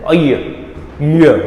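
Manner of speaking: "અય", then "ય", as separate model sounds; teacher modelling "અય, ય"; pronunciation demo. Shown as a demonstration. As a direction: demonstrate "અય" and "ય" with a pause between. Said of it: "અને આનું ઉચ્ચારણ".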